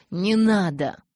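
«Не надо!» snapped out loud and cold, stress on «надо»; man cutting off his lover's reassurance, icy. Acted. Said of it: "В его голосе звучал лёд".

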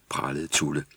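ordinary delivery, nothing marked

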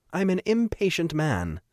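high-pitched, self-satisfied commander; imperious Trevor. Acted. Trevor answers O'Shea, high and self-satisfied. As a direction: say it high, lightly in character.

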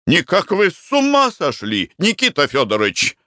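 high-pitched indignant outcry; crying out. A narrator's reading. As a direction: exclaim in shocked protest, pitch leaping up. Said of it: "вскричал Марко Данилыч". (возмущённый вскрик)

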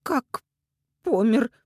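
high, halting shock; stunned, horrified question; not her own voice. A narrator's reading. Mother learns her son died, stunned. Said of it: "говорила не своим голосом".

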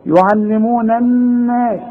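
الناس with the doubled ن held nasal; ghunna on doubled noon. Shown as a demonstration. Teacher models the ghunna in يعلمون الناس. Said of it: "اهي النون اهي المشددة اللي لازم تغنى".

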